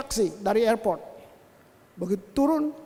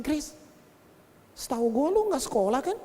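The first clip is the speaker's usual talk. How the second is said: ordinary delivery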